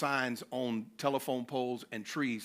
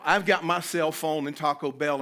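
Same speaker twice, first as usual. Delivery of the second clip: usual speaking voice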